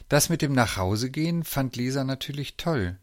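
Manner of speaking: ordinary delivery, nothing marked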